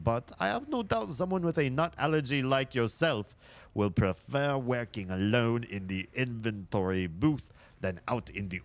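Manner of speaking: put-on French accent with swooping pitch; bad French accent. Streamer reads Jules's reassignment note to Carla, bad French accent. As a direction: read it out in a hammed-up French accent. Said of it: "Such a bad French accent".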